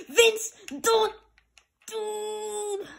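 high-pitched name yell, then a held wail; exasperated outcry. Real, not acted. Gamer cries out at his player, high exasperated yell. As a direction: cry the name out, then stretch the last word.

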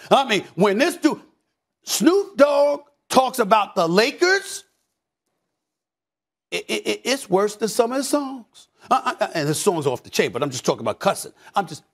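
usual speaking voice

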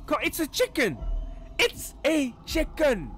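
exasperated. Real, not acted. Exasperated at fearing a chicken.

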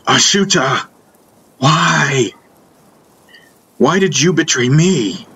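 wounded, angry appeal to the one who betrayed you; angry plea. A narrator's reading. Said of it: "I was angry, so angry".

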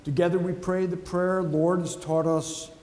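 normal talking voice

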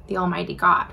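ordinary talk

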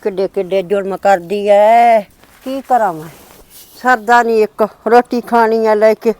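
low, soft, downtrodden complaint; weary lament. Acted. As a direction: grumble helplessly to yourself, low and slowing. Mother-in-law left alone, laments mistreatment.

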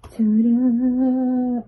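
a loud, high ta-da; excited reveal. Real, not acted. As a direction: present the photos like a magic trick. Revealing more photos.

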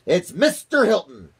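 high, loud announcement; crying out. A narrator's reading. President telling the spy his answer, loud excited cry. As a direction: call out the answer in triumph, high-pitched. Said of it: "cried the president".

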